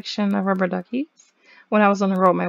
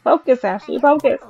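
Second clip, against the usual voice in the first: louder pep talk to herself; self-coaching; 4 semitones higher, 7 dB louder than usual. Real, not acted. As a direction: push yourself to focus, louder at the end. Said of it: "I need to focus".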